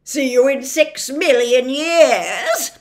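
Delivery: loud, slow taunting officer voice; Officer Shrift. A narrator's reading. Officer Shrift leaving the prisoners, loud slow taunt. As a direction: gloat as you leave them. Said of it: "said Officer Shrift".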